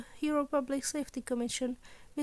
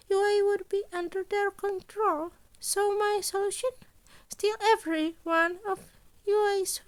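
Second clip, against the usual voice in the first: higher, brighter little principal voice; a scheming principal, a touch higher and louder; Nezu's voice; 7 semitones higher, 5 dB louder than usual. A narrator's reading. Principal Nezu explaining his deal, higher and louder.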